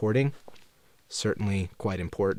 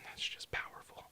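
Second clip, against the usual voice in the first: whispered; 15 dB quieter than usual. Acted. Awed aside about the book.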